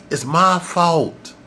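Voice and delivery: "It's MY fault" said slowly, my pressed; slow stressed admission. Shown as a demonstration. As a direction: lean on my, drawn out.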